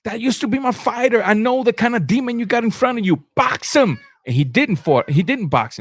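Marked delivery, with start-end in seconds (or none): 0.0-4.0 corner pleading. Retold or imitated. Corner quote continued.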